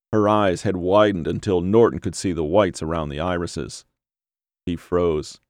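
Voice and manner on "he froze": usual speaking voice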